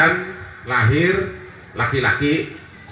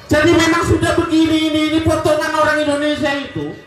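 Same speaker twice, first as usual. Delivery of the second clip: fiery preaching; 11 semitones higher than usual. Acted. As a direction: preach it up at full force to the crowd. Preacher rouses the congregation. (ceramah berapi-api dengan suara tinggi dan lantang)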